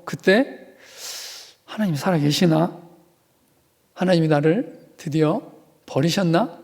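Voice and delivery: ordinary talk, nothing marked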